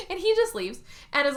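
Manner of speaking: ordinary talk, nothing marked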